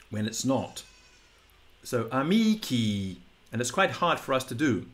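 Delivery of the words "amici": amici drawn out, stress on mee, long falling kee; long unstressed final vowel. Shown as a demonstration. Teacher showing what is hard for English speakers, long unstressed ending. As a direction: hold the last i of amici without stressing it. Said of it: "the long vowel on the end".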